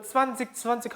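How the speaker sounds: zwanzig ending in a hard k, "tsvan-zik". Acted. Student asks teacher about what they hear, hard-k zwanzig. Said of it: "저는 들을 때마다".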